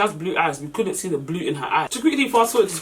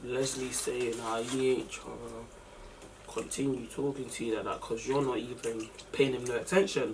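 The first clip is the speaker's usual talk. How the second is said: hushed relayed message; 6 semitones lower, 12 dB quieter than usual. Retold or imitated. Wings relaying message, low voice.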